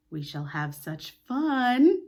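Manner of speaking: slow, drawn-out enticing. Acted. Peter tempting Wendy with one eye on her, slow. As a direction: dangle it slowly to tempt her.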